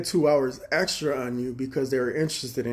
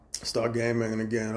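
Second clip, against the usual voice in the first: ordinary talk, nothing marked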